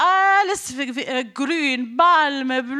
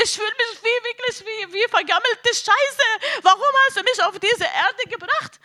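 raised-pitch, anguished reenacted plea to God; desperate pleading; 6 semitones higher than usual. Woman retelling her prayer to Allah, desperate pleading. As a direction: plead as if demanding to be heard.

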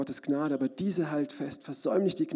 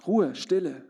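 ordinary talk, nothing marked

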